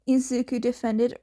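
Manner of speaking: ordinary delivery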